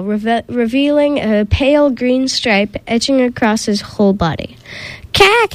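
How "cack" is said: high startled gasp of a snake; gasped cack. Acted. Snake sees himself turning green, high startled gasp. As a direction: gasp it out in sudden shock. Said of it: "he gasped".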